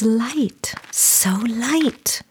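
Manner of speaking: soft airy whisper of wonder. A narrator's reading. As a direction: breathe it out, hushed. Narrator marvels at lightness.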